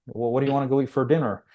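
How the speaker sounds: rattled off quickly without a pause; fast. Retold or imitated. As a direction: rush through it, brushing it aside. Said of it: "he said it real fast".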